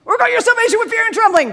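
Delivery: high, loud, rapid-fire command; sledgehammer bark. Acted. Preacher mimicking Paul hammering the Philippians, loud and overbearing. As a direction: hit them with it like a sledgehammer. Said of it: "killing a fly with a sledgehammer".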